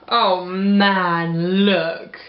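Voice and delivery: ordinary delivery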